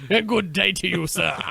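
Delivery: put-on loud, lightly accented good day; a polite English brush-off; mock English gent. Sign-off to the sausage party, mock English. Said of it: "pull that accent out".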